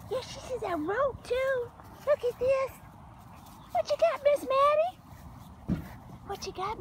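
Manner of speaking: high, sing-song coo to the puppy. Real, not acted. Owner coos at her rescue puppy in high baby talk.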